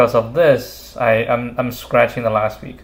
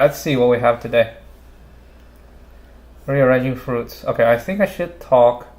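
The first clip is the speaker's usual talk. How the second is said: ordinary talk, nothing marked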